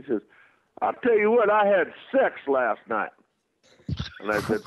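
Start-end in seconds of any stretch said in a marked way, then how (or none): 0.8-3.1 put-on higher, louder boastful young fan; puffed-up brag from a young guy; bragging fan. Fan bragging in bar story.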